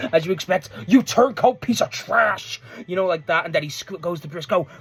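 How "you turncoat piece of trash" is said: slow, louder imitated outrage; livid. Imitating Don Callis livid at Andrade turning, outraged. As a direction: spit the insult out slowly, higher than usual. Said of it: "Don Callis is livid".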